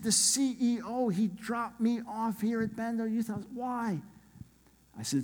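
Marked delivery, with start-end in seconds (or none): 0.0-4.0 high-pitched puzzled protest; imitated baffled businessman. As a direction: complain in bewilderment, imitating the stranded guest. Baffled hotel guest's complaint retold.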